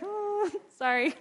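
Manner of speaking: laughing apology. Retold or imitated. Mother apologizing to the staring church people, laughing sheepishly. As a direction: caught out, laugh it off.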